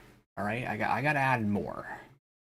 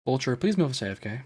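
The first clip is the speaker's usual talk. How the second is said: rushed fast command; hurried through the words in one breath; too quick; much faster than usual. Real, not acted. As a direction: rattle the request off quickly. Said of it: "I talked too quickly there".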